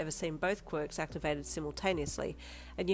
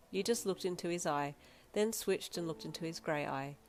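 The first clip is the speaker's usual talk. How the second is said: plain reading voice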